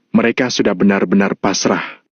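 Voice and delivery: ordinary delivery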